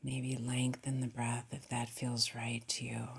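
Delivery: hushed, settled, calming guidance; guided meditation. Acted. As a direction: guide the breath gently, leaving long pauses.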